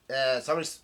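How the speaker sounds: ordinary delivery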